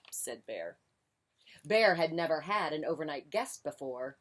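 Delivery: ordinary delivery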